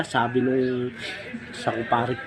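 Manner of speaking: ordinary talk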